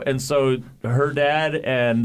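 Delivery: usual speaking voice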